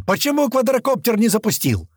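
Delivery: high-pitched angry questioning; yelled demand. A narrator's reading. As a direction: chew out a crewman over a mistake. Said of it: "заорал Туман".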